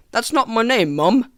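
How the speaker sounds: put-on British child correcting mum; mock-British complaint to mother; British accent. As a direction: protest as a British kid. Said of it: "pioneers had British accents in that scene".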